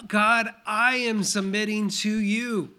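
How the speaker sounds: a lightly voiced eager believer vowing to God; mountaintop prayer. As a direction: pray it like you're on top of the world. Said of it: "on that mountaintop saying".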